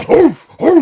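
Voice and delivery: loud mock dog bark, high and booming. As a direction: bark like a big scary dog. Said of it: "the dog's like".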